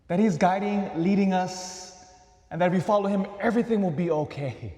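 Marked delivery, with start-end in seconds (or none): none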